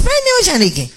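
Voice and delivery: high mocking quote from the mother; imitated high voice. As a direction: voice jumps up high for her line. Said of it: "me dice".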